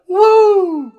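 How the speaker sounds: shrill, very high, loud woo; Ric Flair woo. Retold or imitated. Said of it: "Ric Flair, though".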